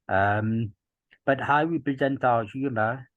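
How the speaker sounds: usual speaking voice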